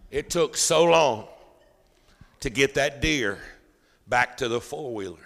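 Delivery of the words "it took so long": normal talking voice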